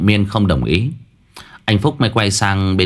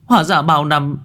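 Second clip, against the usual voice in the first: anguished wailing; 9 semitones higher than usual. A narrator's reading. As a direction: cry out to a dead brother in grief. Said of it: "Cô Trà đọc bảng thông tin mà ngã khuỵu".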